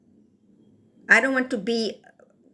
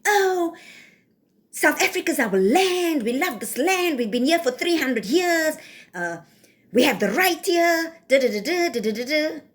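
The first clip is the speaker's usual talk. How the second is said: raised, whiny mock voice of a settler claiming the land; mocking imitation; 5 semitones higher than usual. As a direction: sneer it out higher, then trail off into da da da. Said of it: "You want to".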